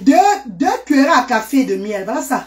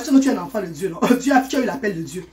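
ordinary delivery

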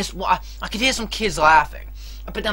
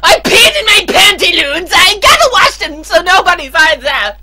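put-on higher, louder childish voice; reading note as a kid; 9 semitones higher, 9 dB louder than usual. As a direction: read it out as a whiny little kid. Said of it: "something written on the paper".

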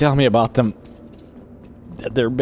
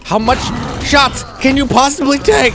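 loud, much higher shout; battle yell; 13 semitones higher, 6 dB louder than usual. Real, not acted. Player fighting a monster, loud battle yell.

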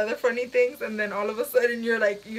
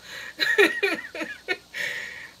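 high laugh. Real, not acted. Laughing fit.

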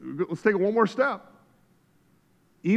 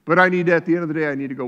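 ordinary delivery

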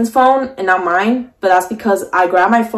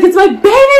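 very high, loud, hammed-up protest; retold frantic wail; 11 semitones higher, 6 dB louder than usual. Woman pleading for her car in retelling, frantic. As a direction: re-enact wailing over your precious car. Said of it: "losing my mind".

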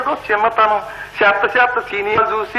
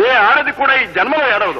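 hammed-up wail, higher and crying out; mock crying shout; 5 semitones higher than usual. Husband mocks daughter's crying. (ఏడుపు నటిస్తూ అతిగా అరుపు)

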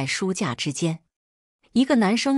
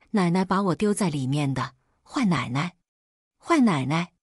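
put-on mildly tearful little girl's complaint; tearful child. A narrator's reading. Frightened daughter blaming grandma to her mother, mildly tearful. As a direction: cry it out like a child, understated. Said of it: "依依带着哭腔喊道".

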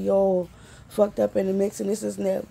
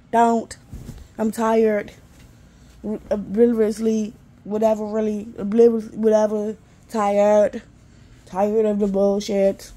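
louder, exhausted anger; loud fed-up venting; 6 dB louder than usual. Real, not acted. Fed up with family's demands, loud and worn out. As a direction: bark out how tired you are.

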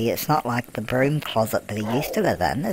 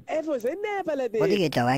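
very high and slow squeak; squeaky high voice; 13 semitones higher, much slower than usual. Acted. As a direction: stretch it out in a squeaky falsetto.

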